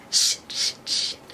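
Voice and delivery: whispered swearing; hushed acted panic; muttered panic. A narrator's reading. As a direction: hiss it in a whisper, under the breath. Character panics under her breath.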